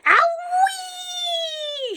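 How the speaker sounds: very high hen shriek. A narrator's reading. The hen shrieking after a beak full of medicine. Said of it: "shrieked the hen".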